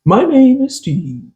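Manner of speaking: slow, drawn-out smooth baritone announcer; radio voice. Retold or imitated. Showing a friend Steve's beautiful voice, slow radio voice. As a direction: stretch it out like a radio host. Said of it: "That's how he talks".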